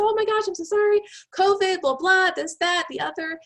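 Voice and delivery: high, loud mock-frantic excuses; flustered apology. HR friend excusing herself to the recruiter, flustered.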